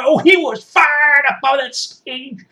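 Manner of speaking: high, drawn-out excited holler; fired-up excitement. Acted. Supporter recalling the son on stage, fired-up excitement. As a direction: holler it slowly, all fired up.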